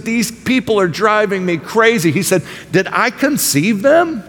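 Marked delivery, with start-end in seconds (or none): 0.0-2.1 higher, exasperated mock complaint; sarcastic Moses. Moses vents to God about the people, sarcastic and higher. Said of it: "Can you hear Moses' sarcasm to God?". 2.7-4.2 higher, slow, dripping with sarcasm; sarcastic question. Retold or imitated. Moses mockingly asks God if he conceived them, slow sarcasm. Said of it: "Can you hear Moses' sarcasm to God?".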